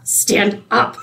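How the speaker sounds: mock-angry repeat, rising into a laugh; retold anger. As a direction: push the repeated command up, half laughing. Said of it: "you just see her get so angry".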